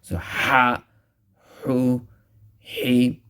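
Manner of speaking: "ha, hu, he" breathy and harsh from mid-throat. Shown as a demonstration. Teacher demonstrating the harsh ha. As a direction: push the air harshly from the throat. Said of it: "pronounced harshly from the center of the throat".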